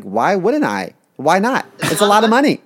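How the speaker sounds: mock-outraged self, getting loud; rising indignant retort. Arguing with partner about adoption.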